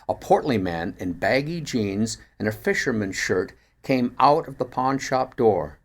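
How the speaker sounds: ordinary delivery, nothing marked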